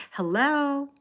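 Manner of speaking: high-pitched telephone hello; phone voice. Shown as a demonstration. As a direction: answer the phone in a put-on high voice. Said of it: "In my phone voice".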